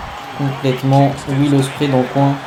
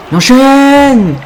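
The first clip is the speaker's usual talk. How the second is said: rising to a big high drawn-out shout; excited call; 11 semitones higher, 6 dB louder, much slower than usual. Real, not acted. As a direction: call it out like a wrestling commentator. Calling out a combo live.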